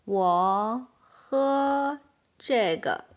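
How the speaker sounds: word by word, with gaps; slow model sentence. Shown as a demonstration. Teacher modelling "I drink this one", slowly word by word. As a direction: separate each word for learners to repeat.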